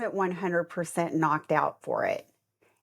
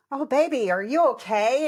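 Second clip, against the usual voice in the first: higher, tender worried voice; concerned husband; 6 semitones higher than usual. Retold or imitated. Matt rushing to his wife, mock-tender concern. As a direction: comfort her like a worried husband. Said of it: "he's like".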